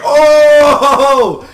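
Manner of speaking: long, loud crowd "ohhh"; crowd reaction. Retold or imitated. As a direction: imitate a shocked crowd, drawn out and loud. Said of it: "the crowd was like".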